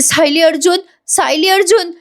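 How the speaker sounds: high, plaintive repeated names; mock tearful. Priya cannot get the news out, mock tearful. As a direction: imitate someone too upset to finish. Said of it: "Priya रडत रडत म्हणते".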